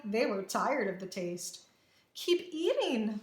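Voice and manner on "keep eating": slow, high-pitched scolding order. A narrator's reading. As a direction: drag it out as a stern command. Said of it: "Maria scolded".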